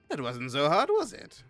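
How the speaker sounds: smug skeleton. Acted. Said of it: "they're just like".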